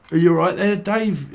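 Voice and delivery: hearty greeting. Retold or imitated. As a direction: greet him like an old mate. Acting a cheerful greeting.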